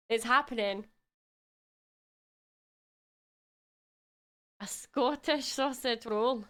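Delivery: playful put-on Scottish accent held through the stream. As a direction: lean into a light Scottish brogue, rolling the words. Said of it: "I don't know what happened to my accent".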